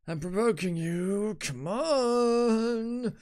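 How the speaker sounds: high, drawn-out goading; provoking taunt. Acted. Fighter trying to provoke an opponent, taunting.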